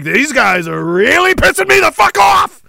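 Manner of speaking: fed up and yelling at the enemy; furious shout. Retold or imitated. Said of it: "And it's like".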